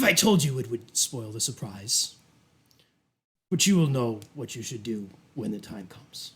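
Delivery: low, measured briefing with deliberate pauses; sinister duke. Acted. Duke sending Bridget to a presentation, coolly commanding. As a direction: hand down instructions with unhurried authority. Game master plays the Duke.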